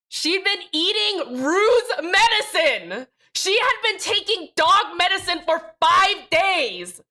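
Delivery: high, loud, slow outrage; telling viewers mom took dog pills; disbelieving punchline. Real, not acted. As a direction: lean in hard on the absurd reveal. Punchline of mom's dog-medicine story.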